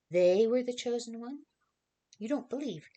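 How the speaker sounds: he raises his voice in alarm; alarmed outburst. Acted. Joseph warns Mary, voice rising in alarm.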